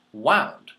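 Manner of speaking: wound said with an ow sound, like round; wound as in winding. Shown as a demonstration. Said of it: "I wound it around my hand".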